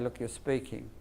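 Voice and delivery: low, soft and firm; adult tone. Shown as a demonstration. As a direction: drop the voice and stay steady. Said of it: "in that tone".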